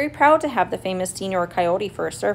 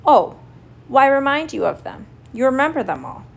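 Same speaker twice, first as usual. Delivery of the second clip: higher, evasive brush-off; glib, raised-voice dodge; quick cover-up; 5 semitones higher, 4 dB louder than usual. A narrator's reading. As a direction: open loud on the exclamation, then wave it away. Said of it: "he said quickly".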